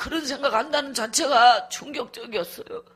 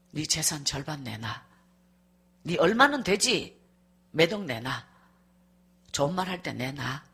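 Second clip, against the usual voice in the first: low, soft, cold demanding voice; husband demanding; 8 semitones lower, 5 dB quieter than usual. Retold or imitated. Husband demands half her money for the divorce, low and cold. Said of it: "이혼해주겠다고 저보고".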